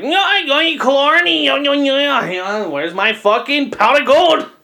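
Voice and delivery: goofy leprechaun who only talks gold; mock leprechaun. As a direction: play a cartoonish leprechaun after a bump on the head. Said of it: "You think you're the leprechaun".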